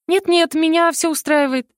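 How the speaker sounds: high, quick refusal; cling tighter, refusing; hasty refusal. A narrator's reading. Heroine refusing to get down, high hasty refusal.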